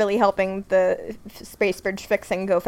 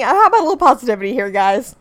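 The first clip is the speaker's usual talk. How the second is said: mock chipper; 3 semitones higher, 8 dB louder, much faster than usual. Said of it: "He's saying".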